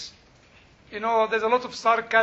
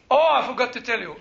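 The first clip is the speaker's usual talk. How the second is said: ordinary talk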